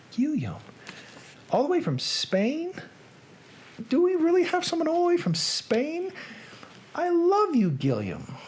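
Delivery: high-pitched delighted amazement; thrilled. Real, not acted. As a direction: gush in a high, excited voice. Delighted by viewer from Spain.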